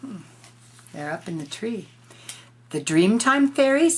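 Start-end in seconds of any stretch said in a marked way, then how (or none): 2.7-4.0 higher, louder knowing animal voice; lightly voiced tiger. Tiger answering the children, a hint of a creature voice. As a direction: announce it like a big friendly cat.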